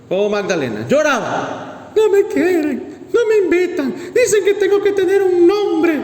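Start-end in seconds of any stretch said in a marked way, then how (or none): 1.9-6.0 mock crying complaint. Complaining tearfully to his father-in-law, mock crying. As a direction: whine like a hurt child, half joking. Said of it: "lloraba a mi suegro".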